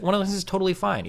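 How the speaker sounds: ordinary talk, nothing marked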